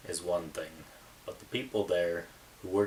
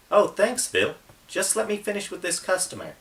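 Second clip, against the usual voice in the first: much higher, bright-toned female voice for Chelsea; cheerful young woman; 13 semitones higher, 7 dB louder than usual. Acted. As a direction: lift the voice high and play a friendly cashier. Chelsea answers Phil.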